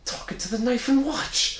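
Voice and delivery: high, baffled Portuguese-accented imitation; thick Portuguese accent. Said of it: "really thick accent".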